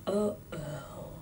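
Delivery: hushed, slow whisper; child whispering uh-oh. A narrator's reading. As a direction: whisper to a classmate in dread. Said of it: "Amy whispered".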